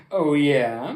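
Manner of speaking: cocky reply. Acted. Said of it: "replied Leonardo".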